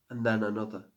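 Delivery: ordinary delivery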